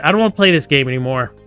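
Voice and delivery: ordinary talk, nothing marked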